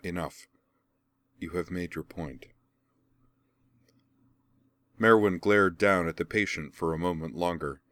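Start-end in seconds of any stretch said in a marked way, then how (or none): 0.0-2.5 soft, much softer steady word; calm her friend down gently; quiet and calm. Acted. Laura quietly calms Merwin beside her, much softer. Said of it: "she said quietly".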